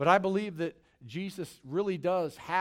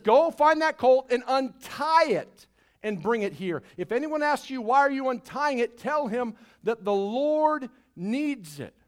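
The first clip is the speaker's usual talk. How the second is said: preaching at full force; 7 semitones higher, 7 dB louder than usual. Acted. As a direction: proclaim the command from the pulpit. Preacher voices Jesus with force.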